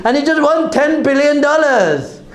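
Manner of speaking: high, drawn-out announcement of a win; hammed-up astonishment; mock amazement. Mocks excitement over golf win.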